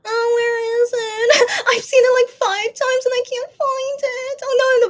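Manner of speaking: high, loud, whining search for the clip; whiny frustration. Real, not acted. Fan searching her phone, whining in frustration. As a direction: wail that the video is lost.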